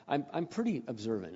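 normal talking voice

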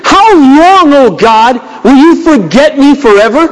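high, pleading cry to God; anguished lament. Acted. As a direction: plead like David in despair. Reading David's lament.

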